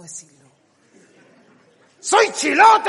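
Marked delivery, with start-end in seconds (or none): 2.0-2.9 high, loud shout of "¡Soy chilote!"; proud Chilote man. Shown as a demonstration. Comedian plays the proud Chilote man, shouting. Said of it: "Así".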